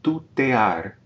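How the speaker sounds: Tutear with e and a in separate syllables; syllables separated. Shown as a demonstration. Teacher models Tutear, syllables pulled apart. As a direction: split the ea of Tutear clearly. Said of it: "we're separating now".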